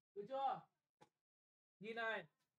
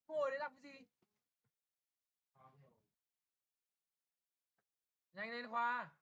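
raised, impatient teacher's voice; loud scolding; 6 dB louder than usual. Real, not acted. Teacher hurrying a dawdling pupil to his seat, loud scolding. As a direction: chivvy the pupil loudly.

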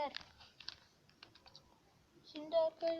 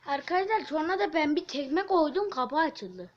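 loud; 2 semitones higher, 11 dB louder than usual. Real, not acted. Bragging about kicking door.

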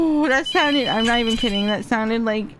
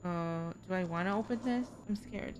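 much softer, scared murmur; nervous hush; 5 semitones lower, 16 dB quieter than usual. Real, not acted. Gamer deciding whether to open it, scared and hushed. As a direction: barely dare to speak. Nervous about opening something.

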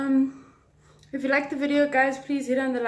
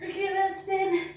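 strained mid-exercise; straining through push-ups; doing push ups; 3 semitones higher, 11 dB quieter than usual. Real, not acted. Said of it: "I'm already perspiring".

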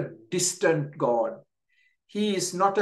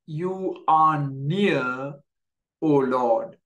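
slow reverent prayer; slower than usual. Acted. Preacher quotes the psalmist's prayer.

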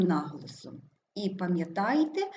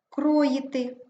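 Кроїти higher on a stressed КРО; stress on first syllable; 5 semitones higher, 4 dB louder than usual. Shown as a demonstration. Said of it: "Наголошений перший склад". (Кроїти з наголосом на КРО)

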